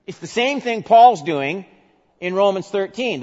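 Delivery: high, loud, driving sermon voice; preaching at full force. Acted. Preacher driving Paul's words home to the church, loud and high. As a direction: hammer it home to the congregation.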